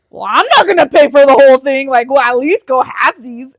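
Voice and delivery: loud, emphatic protest; retold outraged comeback; indignant retort. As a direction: push back loudly, as if retelling an argument. Retelling her indignant reply.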